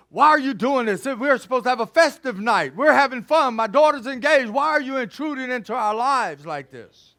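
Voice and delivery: loud, high, offended protest; she's furious he's spoiling the party; indignant outburst. Retold or imitated. Mother protesting to the detective at her party, loud indignant outburst.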